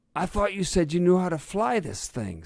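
high, loud accusing protest; indignant complaint. A narrator's reading. Badger blaming the fox after crashing, indignant. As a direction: protest hotly at the pilot.

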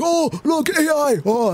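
very high-pitched mocking fanboy squeal; gushing AI fan. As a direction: imitate an over-amazed hype follower, high-pitched. Mocking excited AI fans.

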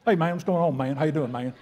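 a light, casual bro greeting; lightly voiced buddy talk; mimicking guys. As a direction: toss it off like a dude saying hey man. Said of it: "Why can you not talk without saying "man".

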